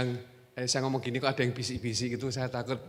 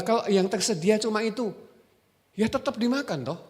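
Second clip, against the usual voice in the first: normal talking voice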